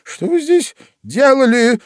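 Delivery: high, loud, irritated tirade; shrieking queen. A narrator's reading. Queen scolds the bowing gardeners, shrieking. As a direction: scold them in a shrill royal squeal. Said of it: "взвизгнула королева".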